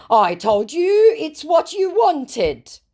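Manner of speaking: high, slow mock scammer. Reading scammer's reply aloud.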